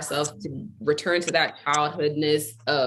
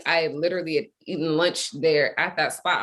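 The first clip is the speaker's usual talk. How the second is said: ordinary talk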